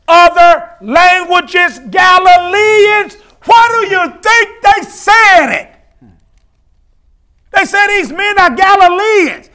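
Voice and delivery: slow build into a high, loud shout; amazed preaching shout. Acted. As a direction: cry out the astonished question, stretching words. Preacher shouts the crowd's amazed question.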